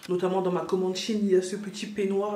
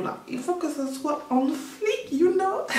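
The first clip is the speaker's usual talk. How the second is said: playful; 10 semitones higher, slower than usual. Real, not acted. Playful jokey emphasis.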